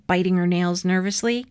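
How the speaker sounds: ordinary delivery, nothing marked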